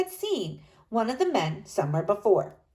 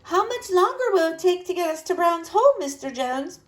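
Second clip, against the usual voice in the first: calling out; 9 semitones higher than usual. A narrator's reading. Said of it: "Mama called out".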